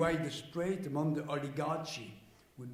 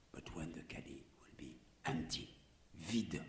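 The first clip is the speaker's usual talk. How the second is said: hushed whisper; quiet whispered warning; 11 semitones lower, 9 dB quieter than usual. Real, not acted. Whispered aside continues.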